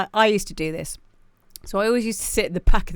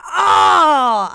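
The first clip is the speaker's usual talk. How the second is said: loud high shout of recognition; excited shout; 8 semitones higher, 9 dB louder than usual. Retold or imitated. Host realising she loves the genre, shouting. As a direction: yell it out as it suddenly clicks. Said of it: "And I'm like".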